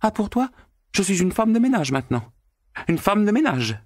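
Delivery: very high, fast, offended cry; indignant shout. A narrator's reading. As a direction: throw the insult back at him. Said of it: "criait Natacha".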